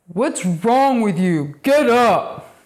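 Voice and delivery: imitating an impatient father barking at a kid; dad's harsh retort. Dad scolding his daughter on the couch, imitated harshly. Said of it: "my dad just said".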